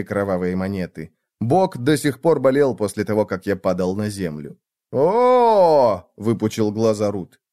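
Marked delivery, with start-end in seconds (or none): 4.9-6.1 high, loud "oh" of delight; delighted gasp. A narrator's reading. Friend sees the coins, delighted. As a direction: let out a big impressed "oh". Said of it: "явно от восторга".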